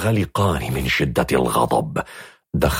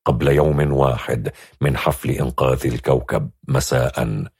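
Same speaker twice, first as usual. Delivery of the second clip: plain reading voice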